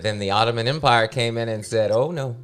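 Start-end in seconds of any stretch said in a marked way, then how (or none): none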